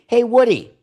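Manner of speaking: high, loud call to Woody. Retold or imitated. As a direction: shout across the room for Woody. Calling out to Woody.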